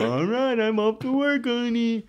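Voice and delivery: put-on high, cheery old-fashioned husband leaving for work; sitcom husband voice. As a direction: play a chipper fifties dad heading off to the office. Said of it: "The image in Drew's head is".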